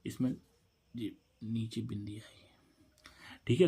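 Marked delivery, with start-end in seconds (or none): 0.0-2.5 breathy whisper; whispered side remark; whispered aside. Real, not acted. Aside about the dot.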